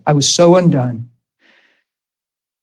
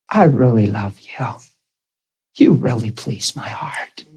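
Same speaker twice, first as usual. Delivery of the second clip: whispered tenderness; 4 semitones lower, 4 dB quieter than usual. Retold or imitated. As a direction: breathe the words like an intimate secret. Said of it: "he's saying stuff like".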